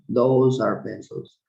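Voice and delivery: slow, clear teacher demo of Those are pencils; slow model sentence. Shown as a demonstration.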